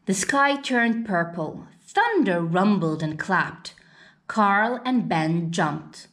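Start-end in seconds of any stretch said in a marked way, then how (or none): none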